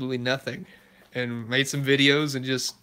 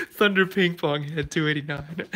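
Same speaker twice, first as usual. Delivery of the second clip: talking through real laughter, pitched up; laughing hard; 3 semitones higher than usual. Real, not acted. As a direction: crack up while saying it. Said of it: "I'm laughing so hard at that".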